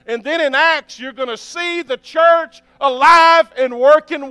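revival-style sermon push; fired-up preaching. Acted. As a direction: preach it out to the whole room. Preaching at full force.

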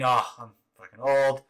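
ordinary delivery

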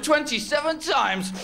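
drawn out slow, savoured; milk each word proudly; slow triumphant brag; slow boast. Acted. Boasting to doctor.

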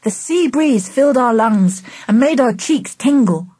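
plain reading voice

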